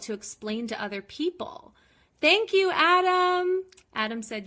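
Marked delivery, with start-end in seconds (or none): 2.2-3.6 high, loud call across the room; calling out thanks. Real, not acted. Thanking Adam off camera for a compliment, called out loud and high. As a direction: shout your thanks to someone in the next room.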